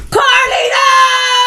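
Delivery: very high, loud sung-out call; high, loud drawn-out playful call; mock shout. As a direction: belt her own name out, drawn long. Calls herself out in an aside.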